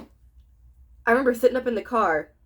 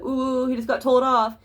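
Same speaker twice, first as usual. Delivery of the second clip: mocking onlookers; 3 semitones higher than usual. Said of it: "faces going".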